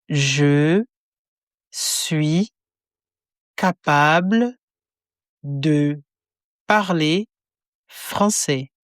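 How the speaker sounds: each word spaced apart for repetition; slow word by word. Shown as a demonstration. Teacher splitting the phrase into slow separate words. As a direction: break it into slow single words.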